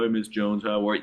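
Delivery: low and soft, a lifeless droopy greeting; mock Eeyore phone greeting, low and dull. Shown as a demonstration. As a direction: sound like a bored donkey with no energy at all. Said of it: "You don't wanna sound like Eeyore".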